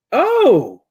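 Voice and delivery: high loud startled oh; surprised oh. Acted. Emil startled on seeing Sonny, high and loud. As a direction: jump at the sight. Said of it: "He's like".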